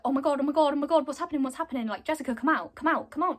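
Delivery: hurried mock alarm; retold panic. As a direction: imitate frantic onlookers pleading, words tumbling out. Retold onlookers panicking at locked door.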